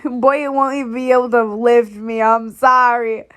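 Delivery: loud laugh through words; cracking up, loud; laughing loudly. Real, not acted. Laughing at character.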